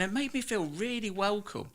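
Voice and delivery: ordinary talk, nothing marked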